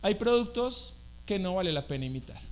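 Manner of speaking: usual speaking voice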